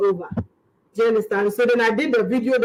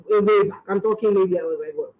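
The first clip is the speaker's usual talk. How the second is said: ordinary talk, nothing marked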